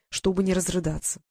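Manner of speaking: ordinary delivery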